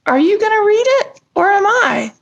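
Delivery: a lightly voiced high puppet, eager and pushy; chirpy puppet eager for the story. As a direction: nudge your friend to get on with it. Puppet voice urging reading.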